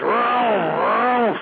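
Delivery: drawn-out vroom noises; imitated engine revving. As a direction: rev it like a truck engine.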